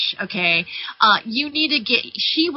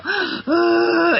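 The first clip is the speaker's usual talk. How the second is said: mock dramatic gasp; 9 semitones higher, 4 dB louder than usual. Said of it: "lie down for two days and go".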